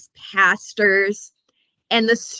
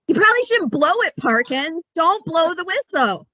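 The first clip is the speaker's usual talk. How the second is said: mock warning; 9 semitones higher than usual. Warning the character Parkins.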